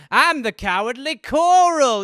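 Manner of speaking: loud, slow, high hammed-up creature; cowardly coral. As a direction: announce yourself grandly and slowly. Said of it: "someone's like".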